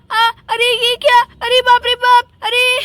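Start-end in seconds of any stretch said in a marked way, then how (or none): none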